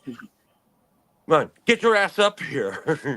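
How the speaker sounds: mock coach yell with a laugh in it; retold yelling. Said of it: "they're screaming".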